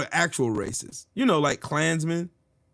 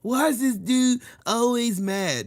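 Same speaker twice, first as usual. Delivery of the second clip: high, slow, sneering mimicry; mocking whine; 6 semitones higher than usual. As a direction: play the critics as whiny and dumb. Mocking critics' question.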